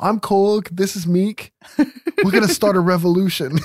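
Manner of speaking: light New Zealand accent impression; lightly voiced New Zealand Korg; Kiwi accent. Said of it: "you can tell 'cause of the Kiwi accent".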